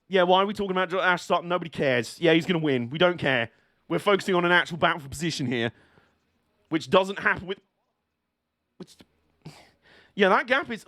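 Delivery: normal talking voice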